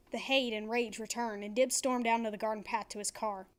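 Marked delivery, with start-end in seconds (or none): none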